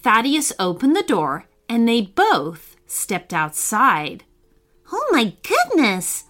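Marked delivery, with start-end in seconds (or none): 4.9-6.2 high astonished exclamation; amazed cry. Acted. Thaddeus steps outside into wonder, crying out amazed. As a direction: gasp out the words in wonder. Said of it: "Thaddeus cried".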